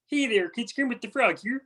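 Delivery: high-pitched cartoon frog voice; Kermit the Frog. Shown as a demonstration. Singer showing the host his best impression, high Kermit voice. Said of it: "I always do, like, Kermit the Frog".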